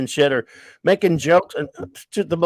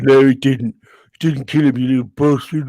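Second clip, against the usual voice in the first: a hint of a grumpy old English writer, louder on No; Alan Moore; 3 dB louder than usual. Host mocking the writer's denial to his podcast friends, a light put-on cranky voice. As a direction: growl it like a cranky old author. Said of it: "the Moore talk".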